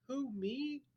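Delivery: surprised schoolboy; puzzled boy. A narrator's reading. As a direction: sound honestly baffled. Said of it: "looking genuinely puzzled".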